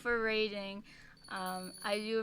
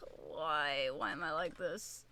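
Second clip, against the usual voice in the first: exasperated groan; 7 semitones lower, 5 dB quieter, slower than usual. Real, not acted. Self-exasperated after mistake.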